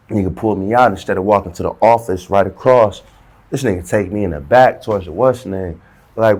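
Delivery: ordinary talk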